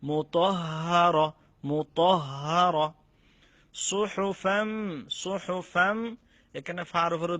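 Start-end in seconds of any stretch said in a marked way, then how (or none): 0.0-2.9 মুতহহার said slowly, lingering on হহ; delay on the doubled হ in মুতহহার; held tashdid. Shown as a demonstration. Teacher modelling the lingering doubled letter, slow. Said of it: "উচ্চারণ করতে দেরি করতে হবে". 3.7-6.2 সুহুফাম with ফাম held into a doubled ম, slow; nasal doubled mim. Shown as a demonstration. Teacher drilling ghunnah on the mim, slow. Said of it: "মিমের উপর তাজদিদ".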